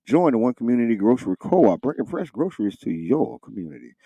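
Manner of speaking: brisk sponsor read. Acted. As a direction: read it like a radio spot. Reads sponsor announcement.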